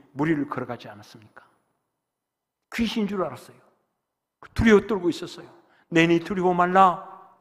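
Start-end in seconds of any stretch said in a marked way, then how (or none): 5.9-7.4 calm command. Acted. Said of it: "말씀하시고".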